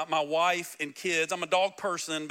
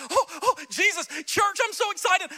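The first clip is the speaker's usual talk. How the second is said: much higher, breathless mock excitement; new believer; 11 semitones higher, 4 dB louder than usual. Pastor imitating new believers eager for church, high and gushing. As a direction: gush like an eager new convert. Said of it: "we were just".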